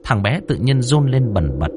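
usual speaking voice

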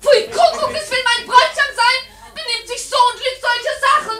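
höher und lauter entrüstet schimpfen; empörtes Schimpfen. Acted. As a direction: pfui rufen wie eine beleidigte Braut. Braut schimpft laut über Bräutigam. (die Braut schimpft laut über den Bräutigam)

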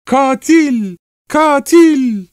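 very high, drawn-out shriek; screaming. A narrator's reading. Wife accusing the murderer, full-voiced scream. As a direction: shriek it at the top of your voice. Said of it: "Kadın avazı çıktığınca".

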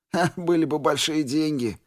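short pained chuckle on Ха; a wry laugh through the pain; bitter smirk. A narrator's reading. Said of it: "Отмычка усмехнулся".

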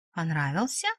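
quietly amused probing, no open laugh; giggly teasing. A narrator's reading. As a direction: tease a friend slyly, hushed. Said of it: "хихикнула Ира".

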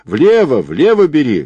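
high, loud shouted direction; urgent shouting. A narrator's reading. Boatswain steers the swimmer away from rocks, urgent high shout. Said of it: "кричал он".

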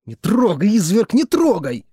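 high, loud panicked scream; struggling scream. Acted. Woman struggling in his grip, screaming at him. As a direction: scream while fighting free. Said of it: "вырывалась она".

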